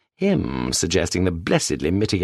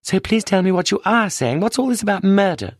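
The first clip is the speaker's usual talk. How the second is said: higher, upset widow demanding answers; Mrs Sherwood, agitated; 7 semitones higher than usual. Acted. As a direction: press him, growing alarmed and indignant. Widow confronts detective, rising alarm.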